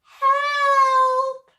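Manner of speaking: long, high, loud wail; cry for help. A narrator's reading. T-Rex scared by the reflection, long high cry. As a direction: scream for rescue, drawn out. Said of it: "the other one cried".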